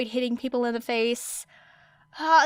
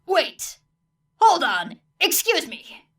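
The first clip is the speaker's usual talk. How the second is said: higher, urgent calls to stop; alarmed calling out; 8 semitones higher, 5 dB louder, slower than usual. A narrator's reading. Teacher halting the students, alarmed. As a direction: cut in loudly to halt them.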